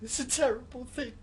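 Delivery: high, forlorn lament; bereft, voice lifting towards tears; grieving. Acted. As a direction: mourn it haltingly, in a small high voice. Grieving his mother, left alone.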